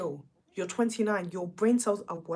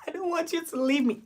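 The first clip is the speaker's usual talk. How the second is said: mock crying; 8 semitones higher than usual. Mocking the tears Jack wanted from Whitney, higher fake sob. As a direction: sob it like a hammed-up clingy girlfriend. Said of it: "wanted her to start crying".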